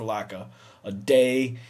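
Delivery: normal talking voice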